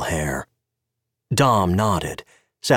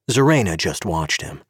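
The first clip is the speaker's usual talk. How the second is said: ordinary delivery, nothing marked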